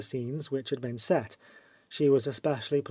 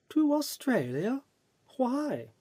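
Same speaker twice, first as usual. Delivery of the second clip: high, startled question; a lightly voiced lady taken aback; mild surprise, pitched up; surprised; 10 semitones higher than usual. A narrator's reading. Langtry voiced by narrator.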